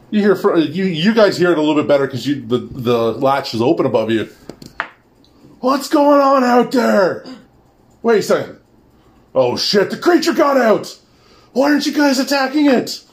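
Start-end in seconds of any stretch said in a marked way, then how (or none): 5.6-13.0 high panicked shouting; panicked guard. Acted. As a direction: yell in alarm at the guards. Guard discovers the escaped creature.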